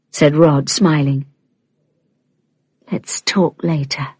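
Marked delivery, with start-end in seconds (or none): 2.9-4.1 soft seductive murmur. Acted. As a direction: murmur it softly, as a private invitation. Leah coaxes Rod toward bed.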